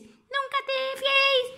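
put-on high child voice repeating the words; high little turtle echoing mama's saying. Little turtle copying mama, high and curious.

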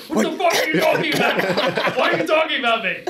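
high, hammed-up indignant challenge, half laughing; calling them out. Angler confronting two whispering crewmates, comically outraged. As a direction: fire off a mock outraged confrontation. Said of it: "I was calling them both out".

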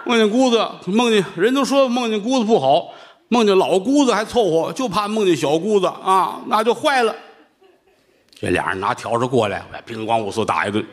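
ordinary delivery

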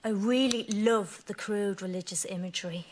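usual speaking voice

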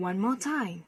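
usual speaking voice

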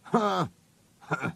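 short scornful grunt; gruntled huff of contempt. A narrator's reading. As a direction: grunt it out dismissively. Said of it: "he grunted".

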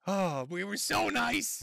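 slow, drawn-out mock whine. Host mocking Mersh's line, slow mock whine. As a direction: parrot him back, slow and whiny. Mocking the clip just played.

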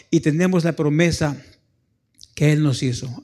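normal talking voice

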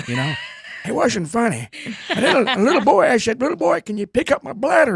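put-on high-pitched old man telling a tale; comic old-timer voice, high; little old man. Shown as a demonstration. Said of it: "little old man".

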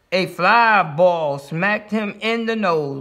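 plain reading voice

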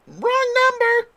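high, slow fake voice; a nervous boy faking a voice on the phone, high and slow; real high voice. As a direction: disguise your voice up high. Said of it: "in a real high voice".

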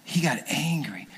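hushed, breathy-free whisper of suspense; storyteller hushing the crowd before the king's rage; dramatic whisper. A narrator's reading. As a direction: drop to a whisper for the reveal.

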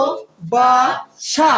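"ভালোবাসা" very slow, four separate beats; syllable by syllable. Shown as a demonstration. Teacher leading students' pronunciation, "ভালোবাসা" in four spaced syllables. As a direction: break "ভালোবাসা" into ভা, লো, বা, সা with gaps. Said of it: "এখন উচ্চারণ করো দেখি".

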